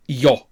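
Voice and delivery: io run together as "yo"; a single syllable "yo" for the io ending; yo sound. Shown as a demonstration. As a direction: glide straight from y into o. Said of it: "the sound of this ending".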